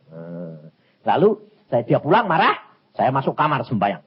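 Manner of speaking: ordinary talk, nothing marked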